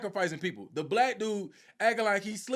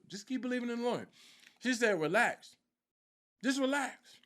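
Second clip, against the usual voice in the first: soothing prophet; 3 semitones higher, 4 dB quieter than usual. Retold or imitated.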